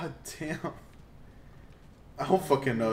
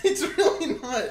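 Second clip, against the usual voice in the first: high-pitched protest through real laughter; laughing; 8 semitones higher than usual. Real, not acted. Gamer denying to friend that it's funny, laughing. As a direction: insist while unable to stop laughing. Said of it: "I'm only laughing".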